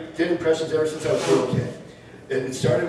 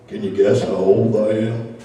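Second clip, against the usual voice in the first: put-on lower, louder creaky old man; old man in the lobby, lower and louder; 6 semitones lower, 5 dB louder than usual. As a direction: play the proud old guy. Old man voiced in a story.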